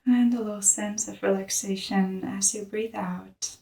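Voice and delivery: soft, hushed meditation voice; softly led breathing practice; guided meditation. Real, not acted. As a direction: guide them gently into the breath, with long pauses.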